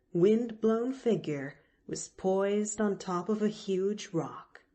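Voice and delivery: plain reading voice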